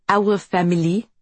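put-on thick French accent, sober. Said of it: "Felicia said in her thick French accent".

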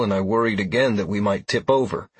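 usual speaking voice

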